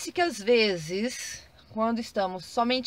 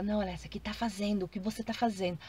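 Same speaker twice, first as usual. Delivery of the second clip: correcting gently; 8 dB quieter than usual. Retold or imitated. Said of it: "Aí eu falo".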